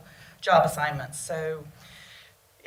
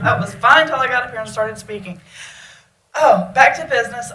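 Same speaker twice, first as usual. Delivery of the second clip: pulling herself together; 4 semitones higher, 10 dB louder than usual. Real, not acted. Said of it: "getting so emotional".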